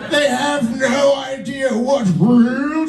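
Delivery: high, slow, hammed-up documentary narrator; David Attenborough. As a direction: observe people like wildlife, lingering on each word. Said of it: "He can narrate any reality show".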